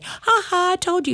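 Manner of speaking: high mock gloat ha ha. Wife gloating at husband the spanker, very high. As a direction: tease like a sibling who was right. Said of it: "I wanted to say".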